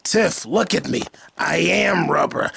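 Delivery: slow, drawn-out mock Chucky; slow deadpan mock Chucky, laughing at the end; slow Chucky line. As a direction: deliver it slowly and smug. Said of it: "You're like".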